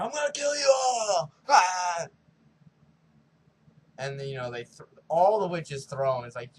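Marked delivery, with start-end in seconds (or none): 0.0-2.1 much higher, much louder yelling threat; charging war cry. Retold or imitated. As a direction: bellow it like a crazed killer charging in. Axeman charging at the witches.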